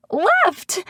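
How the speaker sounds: very high hysterical screech; shrieky, high-pitched outburst; screeched word. A narrator's reading. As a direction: screech it out in one burst. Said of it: "I screech the last word".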